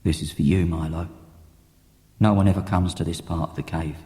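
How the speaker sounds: a loving dad talking while he builds; low gentle father voice; dad's voice. Acted.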